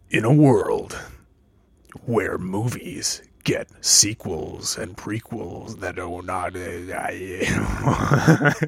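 low, hollow whisper like through a tube; breathy trailer voice down a pipe. Acted. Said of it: "do I sound like a tube and throat singer?".

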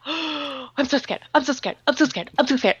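small, softened mock-frightened voice; gasp, then hushed mock fear; imitated scared kids. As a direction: whimper it like a scared child, repeating. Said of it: "And they're like".

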